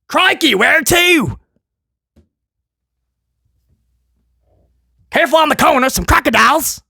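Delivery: badly faked Australian accent, pitched high; botched Australian accent. Wrestling podcaster imagines an Australian safari jeep ride, botched Aussie accent. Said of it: "I don't know what accent that was".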